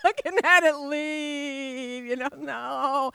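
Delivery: drawn-out tearful lament; mock tearful wail. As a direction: let the no sag like holding back tears. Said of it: "I literally burst into tears".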